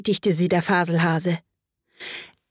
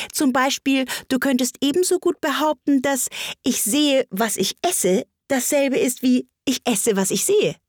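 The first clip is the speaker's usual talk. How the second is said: high, pointed reasoning; lively Hatter, pitched up; argumentative Hatter; 7 semitones higher than usual. A narrator's reading. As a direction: spell out the absurd logic in character.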